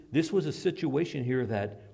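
normal talking voice

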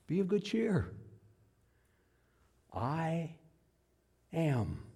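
soft, measured, spaced-out answer; quiet calm reply. Acted. Jesus answering the disciples' cry, quiet calm. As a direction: let each phrase land gently.

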